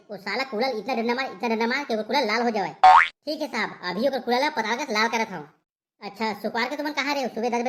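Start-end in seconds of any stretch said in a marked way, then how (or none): 2.7-3.8 drawn-out, louder, lower obedient reply; slow loud yes sir. Acted. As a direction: stretch it out like a dutiful constable. Constable obeys the inspector.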